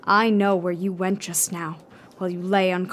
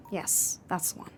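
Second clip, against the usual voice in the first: hushed approval; 3 semitones lower, 9 dB quieter than usual. Acted. Sculptor murmuring while working.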